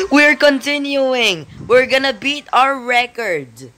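high, keen announcement; real gamer excitement, high-pitched; excited rallying. Real, not acted. Rallying for a record attempt.

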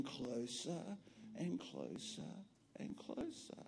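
breathy hush; hushed whisper; whispered and closer. A narrator's reading. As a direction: whisper it for suspense. Suspense building.

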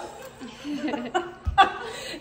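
amused laugh at herself; real, tickled laughter. Real, not acted. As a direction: laugh at your own silliness. Laughs at her own improvising.

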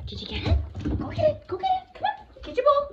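high, bright play voice; excited dog talk. Real, not acted. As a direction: hype the dog up to fetch. Playing fetch with the dog.

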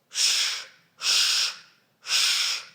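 a voiceless snake-like ш, ш, ш, soft and hissing. Shown as a demonstration. Teacher showing children how a snake hisses, soft ш, ш, ш. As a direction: hiss ш, ш, ш like a snake. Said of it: "Як шипить змія?".

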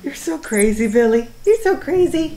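high, loud sing-song to a pet; pet talk. Real, not acted. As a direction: gush over the cat, building up. Cooing at crazy cat.

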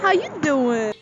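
put-on joking Italian-accent catchphrase; playful, half-successful Italian accent; attempted Italian accent. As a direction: try a bad Italian accent. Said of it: "I can't do an Italian accent".

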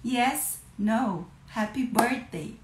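normal talking voice

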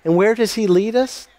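ordinary talk, nothing marked

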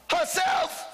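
high ringing shout; called-out answer. Real, not acted. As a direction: throw the word out to the crowd. Preacher calls out answer.

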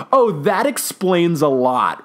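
imitated pointed put-down, drawn out; sassy shade. Professor throwing shade at the psychic, sassy. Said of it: "low-key throwing so much shade".

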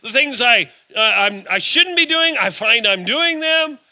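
exasperated Paul. Retold or imitated. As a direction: cry out Paul's frustration at his own sin. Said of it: "Paul even said".

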